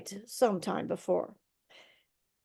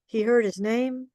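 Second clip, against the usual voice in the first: usual speaking voice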